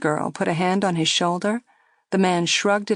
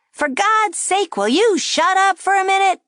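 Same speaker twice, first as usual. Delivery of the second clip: loud dummy voice; 13 semitones higher, 5 dB louder than usual. A narrator's reading. Dummy yelling at the girl before nearby tables. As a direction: shout her down so the room hears. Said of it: "the dummy's loud voice".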